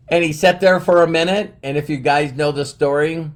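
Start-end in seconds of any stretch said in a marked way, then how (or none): none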